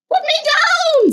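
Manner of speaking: very high squeaky cry of alarm; panicked fish. Acted. Fish dangling in the air, squealing high. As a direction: shriek to be put down. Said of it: "said the fish".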